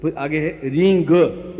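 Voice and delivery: रींग said slowly so the final ग is heard plainly; clear g ending. Shown as a demonstration. Teacher showing the ग sound in रींग, slowly. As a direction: land firmly on the ग of रींग. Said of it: "इसमें ग की ध्वनि है".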